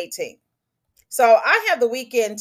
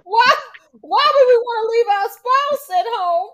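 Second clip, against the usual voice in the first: much higher, louder, excited rant; high, loud disbelieving rant; high excited outburst; 10 semitones higher, 4 dB louder than usual. Real, not acted.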